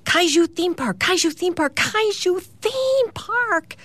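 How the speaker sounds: high-pitched excited chant, last one drawn out; mock yelling. A narrator's reading. Hillary pops in between her parents with an idea, mock yelling. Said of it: "pops in between them yelling".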